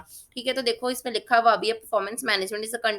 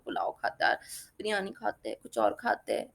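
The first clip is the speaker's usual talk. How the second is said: soft, whispered daydream about food; musing whisper; 3 semitones lower, 7 dB quieter than usual. Retold or imitated. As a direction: whisper it like a tempting thought. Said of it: "अपन कभी-कभी सोचते हैं". (फुसफुसाते हुए ललचाती सोच)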